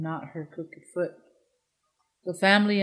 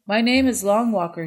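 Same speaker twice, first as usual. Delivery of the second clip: loud, proud self-introduction, slightly higher; announced; 4 semitones higher, 8 dB louder than usual. A narrator's reading. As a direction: proclaim your name loudly to the girl. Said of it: "he announced".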